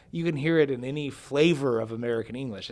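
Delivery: ordinary talk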